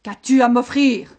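loud, demanding owl-monster; monster's shout. A narrator's reading. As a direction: bellow the demand at the shepherd. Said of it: "cria-t-il au berger". (voix forte de monstre exigeant)

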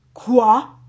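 loud booming roar; roaring ogre. Acted. Ogre roaring at the doctor, loud and furious. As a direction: bellow it in outrage. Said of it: "gronda l'ogre".

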